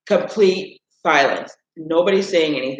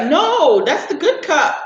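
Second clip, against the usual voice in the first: high-pitched alarmed cry of no; mock panic over the good cup; sister's protest; 10 semitones higher, slower than usual. Sister protesting her brother crushing her cup, high alarmed cry. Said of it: "my sister was like".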